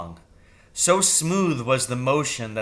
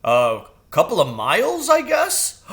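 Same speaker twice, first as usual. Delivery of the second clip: louder, casual bird voice rising high at the end; crow voice; 7 semitones higher, 5 dB louder than usual. A narrator's reading. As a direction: shrug it off lightly as the crow. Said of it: "Jeremy said".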